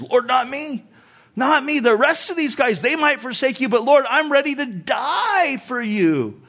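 high-pitched, earnest insistence; totally serious vow. Acted. Peter vowing loyalty to Jesus that night, bold and serious. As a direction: insist boldly, pitch lifted. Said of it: "he was totally serious".

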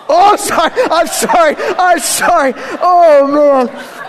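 high, laughing apology. Real, not acted. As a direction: apologize while breaking up laughing. Breaks up, apologizing mid-sermon.

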